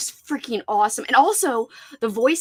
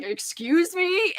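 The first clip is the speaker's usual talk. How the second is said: slow, drawn-out affronted challenge; indignant excuse me; 5 semitones higher, much slower than usual. Retold or imitated. Talking back to the cursing lady, slow indignant excuse me. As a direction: drag it out, offended. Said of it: "I literally was like".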